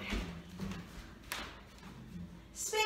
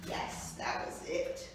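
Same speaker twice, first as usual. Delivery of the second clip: whispered praise; 10 dB quieter than usual. Real, not acted. Quiet praise to the dog.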